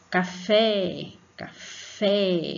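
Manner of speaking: "Café" with "fé" pushed and stretched; stress on last syllable. Shown as a demonstration. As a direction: call "Café", leaning on "fé". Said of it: "mais força pra falar o fé".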